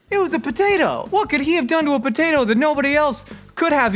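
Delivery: high-pitched comic outrage; exasperated disbelief. Real, not acted. Comedian baffled by praise for a potato, exasperated. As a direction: protest in a high, incredulous voice.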